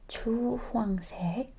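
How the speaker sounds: ordinary talk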